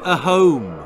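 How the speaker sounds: loud growled answer. A narrator's reading. Troll leader growls his demand, growling. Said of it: "growled the leader".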